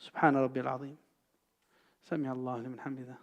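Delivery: soft, low prayer phrases, the second rushed; murmured prayer recitation. Shown as a demonstration. Teacher demonstrating the pause in prayer, murmured and soft. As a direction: murmur the prayer words as in the bow. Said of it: "So there needs to be a pause".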